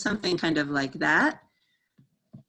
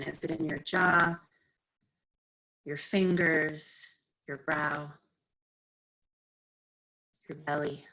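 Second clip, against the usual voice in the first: calm, unhurried meditation guide with long pauses; guided meditation. Real, not acted. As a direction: ease them into stillness, phrase by phrase. Leads a seated meditation.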